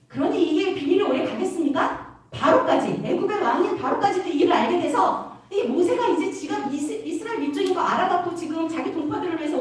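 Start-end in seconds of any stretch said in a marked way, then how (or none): none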